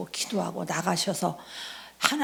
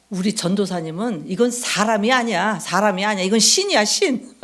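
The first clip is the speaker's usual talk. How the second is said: louder, marvelling praise rising to the end; loud admiring quote; 7 dB louder than usual. Retold or imitated. Pastor praising the evangelist, loud marvelling exclamation. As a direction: boom it out in amazement. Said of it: "나중에 우리 목사님이 그래요".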